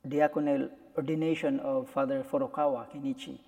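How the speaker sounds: normal talking voice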